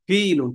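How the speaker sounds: ফিলুন with a long, stretched "ee"; long drawn vowel. Shown as a demonstration. Teacher showing students the elongation, ফিলুন with its first vowel held. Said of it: "টান আছে, টান দিতে হবে".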